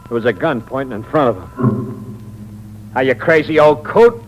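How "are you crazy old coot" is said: alarmed yell. Acted. Gunman yells at uncle with gun.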